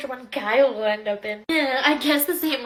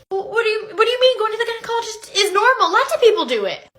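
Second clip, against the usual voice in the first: high, loud, defensive; offended and insistent; indignant protest; 6 semitones higher, 6 dB louder, faster than usual. Acted. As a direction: protest it, pitched up and quick. Student defends himself, offended.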